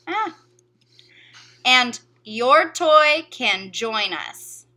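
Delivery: very slow, every word spaced out; slow dictation. Shown as a demonstration. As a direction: read it out slowly so children can write. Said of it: "reads model spelling sentences".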